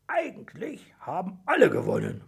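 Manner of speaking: a touch of dragon hiss. A narrator's reading. Dragon settles who won the contest, mildly hissing. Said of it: "fauchte Drachenzahn".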